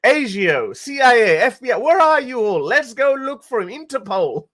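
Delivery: high, loud, hammed-up officer springing into action; mock eager police officer. Vlogger imagining police obeying the troll, in a hammed-up high voice. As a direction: play a gung-ho cop calling in every agency. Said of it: "would be like".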